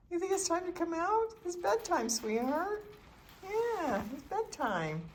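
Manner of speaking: high soothing pet talk; nanny. Real, not acted. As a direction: coax the bird gently to bed. Putting bird to bed.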